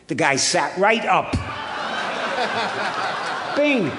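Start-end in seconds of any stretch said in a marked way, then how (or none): 1.4-3.5 breathy laugh. Real, not acted. Audience-style laugh at the story. 3.6-3.9 much higher, loud "Bing" with a laugh; punchy exclamation. Real, not acted. Drunk sits right up at the offer of wine, exclaimed.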